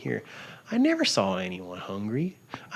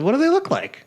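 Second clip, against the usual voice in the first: high, loud incredulous question; astonished curiosity; 6 dB louder than usual. Retold or imitated. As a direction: re-enact wide-eyed asking, pitch way up. Retelling own eager questioning.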